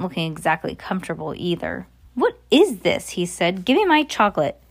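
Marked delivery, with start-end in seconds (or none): 2.1-3.1 demanding. Acted. Greedy boy demanding answers. 3.6-4.6 put-on high-pitched, insistent boy's demand; bratty demand. Bruno demanding chocolate from the witch, high and bratty.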